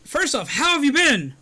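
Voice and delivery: high, loud gushing welcome; excited yelling. Real, not acted. Streamer thanking a friend who turned up, excited yelling. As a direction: shout it out, thrilled and drawn high. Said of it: "I shouldn't yell like that".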